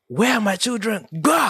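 put-on high, loud yell for the missing kids; angry shout. Angry father shouting across the park for his children. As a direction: bellow it like a panicked angry father.

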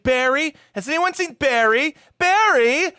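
high, loud calling for Barry. Retold or imitated. Heather calling for her boyfriend at prom, loud and high. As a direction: shout across the prom for him. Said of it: "who had been shouting out".

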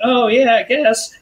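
high, put-on nonchalant voice; playing dumb. She answers his boasts about the ship, mock clueless.

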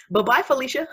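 high, laughing sassy send-off. Acted. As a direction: dismiss them playfully through giggles. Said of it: "you get sassy with them".

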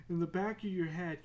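ordinary talk

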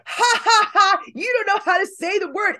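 high-pitched taunting "ha ha ha"; mocking laughter. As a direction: imitate a cast jeering at a mistake. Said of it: "everyone started laughing".

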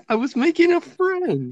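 high whiny mimicry of her; mocking whine. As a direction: whine it like the girl. Mocking the pranked girl.

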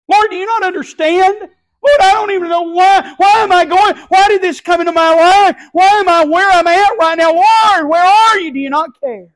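high, loud, pleading shout to the Lord; hammed-up desperate prayer shout; anguished crying out. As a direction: wail every why as if at the end of your rope. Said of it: "cried out to Jesus like that".

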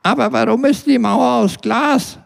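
put-on very high, piping child's question; a little kid asking daddy, wide-eyed. Small child asks his father about the man, very high voice. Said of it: "fragte ein Kind seinen Vater".

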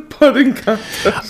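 laughing through the words; chuckling repeat. Real, not acted.

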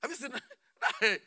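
normal talking voice

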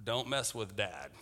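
low, hushed and slow; hushed warning. Acted. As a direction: keep it under your breath. Kids frightened after the slaughter.

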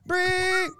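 "Brie" high and held; trying out "Brie". Shown as a demonstration. Host shows Corey's word to co-host, high held "Brie". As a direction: stretch "Brie" on one high note. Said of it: "How do I pronounce this one?".

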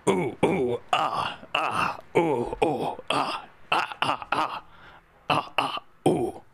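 ape hoots, ooh ooh ah ah; chimp hooting. Pretend. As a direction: hoot like King Kong on request. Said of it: "Can you do that? Go on".

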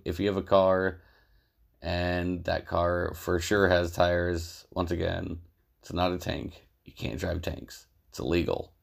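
deadpan sponsor spot, slowing on the key lines; casual host reading a joke ad; mock ad read. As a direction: sell the tire shop like a lazy radio ad.

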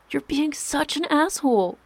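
high-pitched, upset accusation; stung, indignant complaint; hurt anger. A narrator's reading. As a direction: hurl it out, wounded and exasperated. Hurt wife insults husband in quarrel.

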